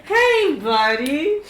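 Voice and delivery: put-on high, loud drunken hey buddy; drunk buddy greeting. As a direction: call out like a tipsy old friend. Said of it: "Merlin's like".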